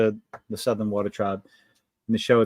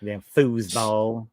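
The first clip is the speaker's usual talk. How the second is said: Waterboy drawl; 2 semitones lower, much slower than usual. Retold or imitated. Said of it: "like Waterboy".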